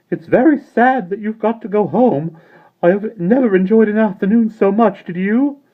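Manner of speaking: high, loud but sober sigh of regret; soberly. A narrator's reading. Mister Wig to the children, sober. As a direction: let the joy drain away.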